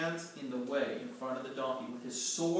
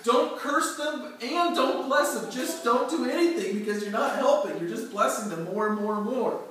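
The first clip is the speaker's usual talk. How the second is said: much higher and louder exasperated rant; angry outburst; 8 semitones higher, 6 dB louder than usual. Acted. Balak scolding Balaam to stop prophesying, loud and exasperated. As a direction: tell him off, fed up. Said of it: "Balak says".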